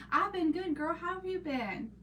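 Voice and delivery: cheerful hello to a commenter; bright greeting. Real, not acted. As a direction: sing out the welcome. Answering viewer.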